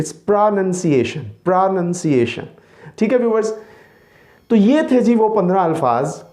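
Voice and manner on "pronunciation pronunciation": pronunciation very slowly, nun not noun; slow English pronunciation; correct pronunciation. Shown as a demonstration. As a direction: model pronunciation carefully. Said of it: "गलत है".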